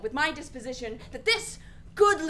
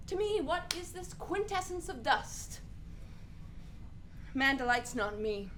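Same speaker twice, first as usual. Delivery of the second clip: ordinary talk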